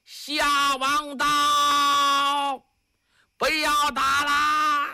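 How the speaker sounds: very slow, high, drawn-out herald's cry; high-voiced shout. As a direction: proclaim it from afar, holding the last syllables. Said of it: "突然间有人高声喊道".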